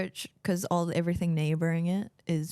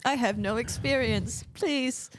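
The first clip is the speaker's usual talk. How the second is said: slow, higher-pitched mock plea; mock begging; 6 semitones higher, slower than usual. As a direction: plead like a hammed-up beggar.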